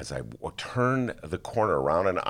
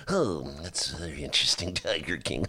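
mock public radio host; 2 semitones lower, 4 dB quieter than usual. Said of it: "much like a host on N P R".

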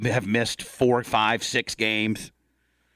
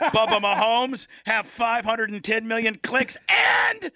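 Mahomes impression; 9 semitones higher than usual. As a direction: brag like a rich star who just beat covid, pitched way up. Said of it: "then he could be like".

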